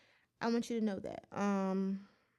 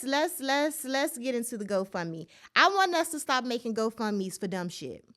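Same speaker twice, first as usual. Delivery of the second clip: ordinary delivery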